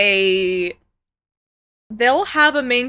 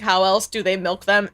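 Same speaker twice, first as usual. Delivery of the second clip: ordinary delivery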